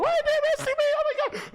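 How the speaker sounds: very high, loud panicked squeal; hammed-up high-pitched panic; freaking out. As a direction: mimic a frantic shriek. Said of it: "she starts freaking out".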